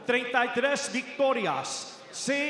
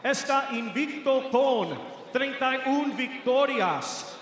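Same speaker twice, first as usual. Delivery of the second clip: normal talking voice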